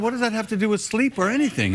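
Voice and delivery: protest, baffled; indignant retort. Retold or imitated. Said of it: "I said".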